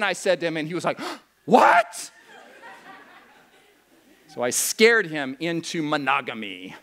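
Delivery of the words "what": a laughing falsetto What; mock shocked gasp. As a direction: gasp then a very high, loud squeaked What. Young man's shocked reaction.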